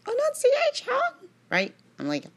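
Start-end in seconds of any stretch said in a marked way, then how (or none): 0.1-0.9 Annunciation called out very high; priest calling out. Retold or imitated. Imitating tall Father Gabriel calling out at Mass. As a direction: sing out Annunciation like a booming priest. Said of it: "He's like".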